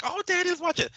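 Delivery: very high-pitched imitation of a kid; mock kid voice. Kids answering their boxer dad, mock high kid voice. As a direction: pipe up high like a child. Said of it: "They're like".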